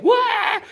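sudden high yelping "wah" of overload; mock overload wail. Speaker mimicking how screens make us hyper, high yelp. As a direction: yelp it like a kid on sugar.

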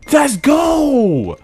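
high, loud celebratory shout; hyped-up gamer yell; excited cheer. Real, not acted.

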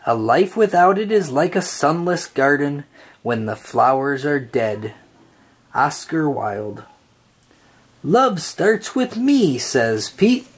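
usual speaking voice

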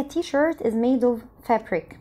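every word of t-shirt is made of fabric spaced out slowly; very slow model sentence. Shown as a demonstration. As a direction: dictate the sentence slowly for the class. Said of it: "شوف الاكزامبل ده كده معايا". (نطق الجملة ببطء شديد كمثال)

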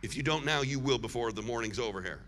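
ordinary talk, nothing marked

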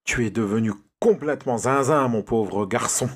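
ordinary delivery, nothing marked

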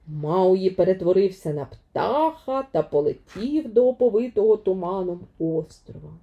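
ordinary delivery, nothing marked

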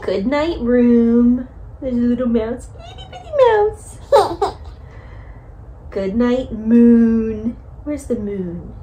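slow, sing-song bedtime reading; reading to a toddler. Acted. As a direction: read it gently to a little one. Reads Goodnight Moon to toddler.

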